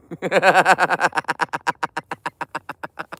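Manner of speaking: long staccato chuckle trailing away; rattling, choppy laughter. Real, not acted. Streamer amused that the dogs heard him, rattling laugh.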